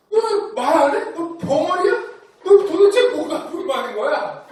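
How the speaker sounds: hammed-up nagging mother; angry mom. Mother scolding silent son, mock angry and shrill. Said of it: "엄마가 화가 나서 이렇게 얘기합니다".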